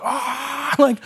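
hammed-up exasperated dad outburst; angry, overheating dad. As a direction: act out a dad boiling over. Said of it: "angry, overheating dad who's like".

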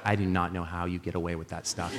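low, soft deadpan as the wife; imitating deadpan disbelief. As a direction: quietly mimic an unimpressed wife. Wife reacting to his cheeky joke.

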